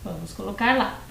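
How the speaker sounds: ordinary talk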